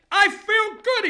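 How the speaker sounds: hammed-up Trump brag; Trump impression. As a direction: puff up like a cocky president. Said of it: "he said".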